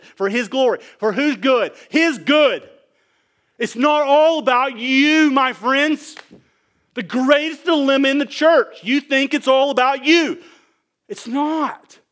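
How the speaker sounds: high, loud, emphatic rebuke; full-force preaching. Real, not acted. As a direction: hammer it home to the congregation.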